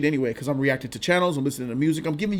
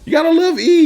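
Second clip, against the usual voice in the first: fond shout; 14 semitones higher, 11 dB louder than usual. Real, not acted. Affectionate shout.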